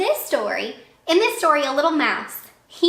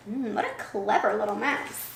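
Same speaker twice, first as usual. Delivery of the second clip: plain reading voice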